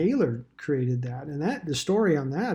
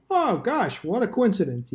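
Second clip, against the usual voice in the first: sarcastic mock surprise; 6 semitones higher than usual. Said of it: "You know, like".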